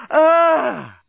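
long, high, loud "ah"; climactic cry. Acted. Lucy at the height of passion, long climactic cry. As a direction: draw the cry out high, then let it fall.

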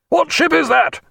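high-pitched roaring challenge; roared hail. A narrator's reading. Captain challenging strange ship in confusion, roared high. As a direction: roar it over the confusion. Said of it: "he roared into the confusion".

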